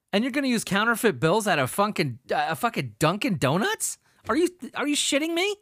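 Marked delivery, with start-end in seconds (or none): 4.3-5.5 incredulous. Real, not acted. Disbelief at the criminals.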